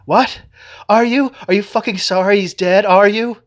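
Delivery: high, loud furious demands; angry. A narrator's reading. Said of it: "She turns on me angrily".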